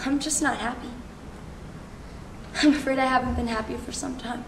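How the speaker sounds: very soft, low confession; quiet sad admission. Acted. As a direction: admit it softly, with the voice sunk low.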